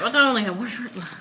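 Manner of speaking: ordinary talk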